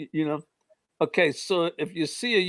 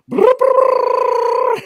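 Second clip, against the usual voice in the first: much higher and louder electronic buzz; imitated device buzzing; 10 dB louder than usual. DJ's detector goes off at his side, loud high buzz. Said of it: "he goes".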